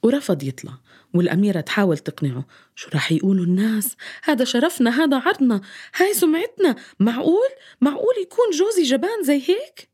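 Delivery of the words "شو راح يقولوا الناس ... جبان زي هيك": rising indignant plea. Acted. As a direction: pitch climbing higher with outrage. Princess pleads, scolds coward husband. (غضب متصاعد وعتاب)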